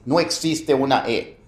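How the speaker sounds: ordinary talk, nothing marked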